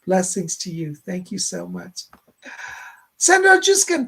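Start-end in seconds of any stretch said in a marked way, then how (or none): 3.2-4.1 excited name call. Real, not acted. Calling out the next donor.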